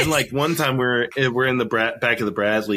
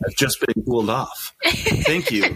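mock relief. Mock relief at soldier's panic.

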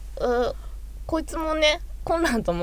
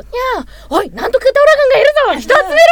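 higher, louder mock guard alarm; mock alarmed shout; 14 semitones higher, 8 dB louder than usual. Friend acting out an isekai guard's alarm, hammed-up shout. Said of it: "みたいな".

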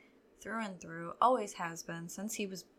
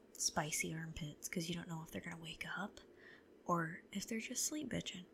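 whisper; 3 semitones lower, 7 dB quieter than usual. Real, not acted. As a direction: whisper low, unvoiced. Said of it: "whispering near sleeping child".

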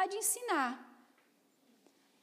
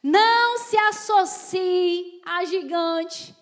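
slow, loud preaching cadence; forceful preaching; 2 semitones lower, 7 dB louder, much slower than usual. Acted. Preacher warning the congregation, slow and loud. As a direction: hammer each warning slowly.

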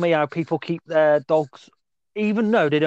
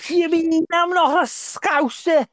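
high-pitched plastic Scouser; hammed-up Scouse impression; bad Scouse accent; 10 semitones higher than usual. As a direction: protest in an overdone fake Scouse. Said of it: "someone trying to do a Scouse impression".

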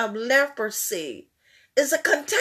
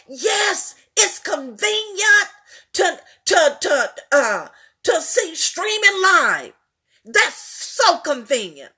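lightly raised preaching voice; 4 semitones higher, 3 dB louder than usual. Acted. Preacher urging the church to come in person. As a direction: urge them to come to church.

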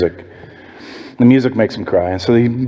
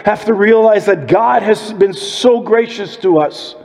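rising preacher build; 8 semitones higher than usual. Acted. Builds the sermon to full preaching.

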